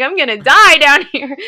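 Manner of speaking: joking that she'll die in the mud; laughing mock panic. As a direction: play the dread up as a joke. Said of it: "I'm starting to panic".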